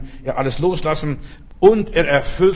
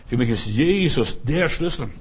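ordinary talk

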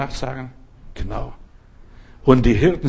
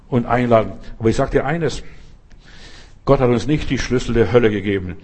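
normal talking voice

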